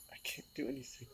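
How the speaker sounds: soft, choked whisper; hopeless words barely breathed; tearful whisper. Real, not acted. Grieving the pet's death.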